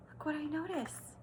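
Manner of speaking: soft gasp, then quiet wonder; hushed surprise. Real, not acted. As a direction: discover something with a hushed gasp.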